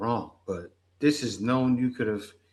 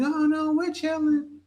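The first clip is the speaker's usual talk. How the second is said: high, airy mock of a carefree girl; mock girly voice. Mocking the girls who insisted they were fine, high and airy. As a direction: brush it off in a much higher mocking voice. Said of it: "they were saying".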